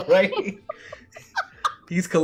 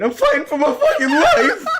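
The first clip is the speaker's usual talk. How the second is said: laughing; 12 semitones higher, 6 dB louder than usual. Real, not acted. Joking about dry sandwich.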